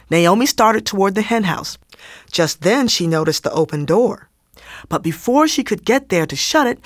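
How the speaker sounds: plain reading voice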